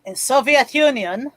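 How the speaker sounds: put-on slow, heavy Russian accent. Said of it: "How good's your Russian accent".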